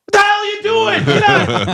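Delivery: high, loud startled yelling; angry shout. Retold or imitated. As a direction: yell at the intruder under the stall. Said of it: "and you just hear".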